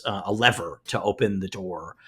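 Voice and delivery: ordinary talk, nothing marked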